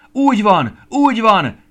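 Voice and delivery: high, very loud cries of agreement; crowd shouting. A narrator's reading. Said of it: "kiabált a többi csillag". (hangos, magas kiabálás)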